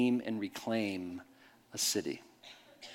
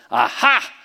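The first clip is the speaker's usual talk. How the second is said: loud, high, triumphant accusation; mock gotcha turned back; 7 semitones higher, 8 dB louder than usual. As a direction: throw his own gotcha right back at him. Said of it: "I said".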